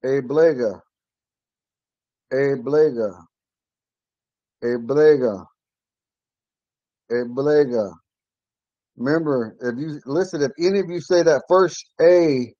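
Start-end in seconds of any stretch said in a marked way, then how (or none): 0.0-7.9 Eblega said slowly and clearly, ending on a falling ga; Ponca word. Shown as a demonstration. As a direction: model Eblega for learners, each syllable distinct. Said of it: "this one right here".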